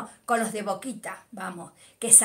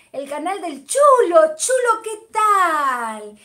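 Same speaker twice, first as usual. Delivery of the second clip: higher, louder, drawn-out hello; excited greeting; 6 semitones higher, 10 dB louder, slower than usual. Real, not acted. Welcoming a viewer to the stream, excited and slow. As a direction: sing out his name in welcome.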